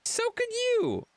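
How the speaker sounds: voiced dog. Dog's voiced comeback.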